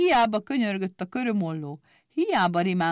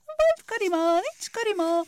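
a very high, gloating taunt; sing-song it with a smirk; mocking grin; 14 semitones higher than usual. A narrator's reading. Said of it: "vigyorgott gúnyosan".

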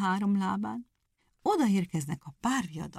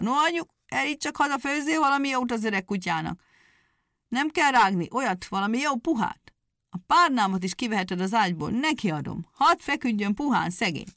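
put-on higher, fond farmer voice, fussing over the old dog; grateful farmer; 4 semitones higher, 3 dB louder than usual. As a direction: farmer to his wife, doting on the dog. Farmer thanks dog, tells wife. (hálás parasztember, magasabb, kedveskedő hangon)

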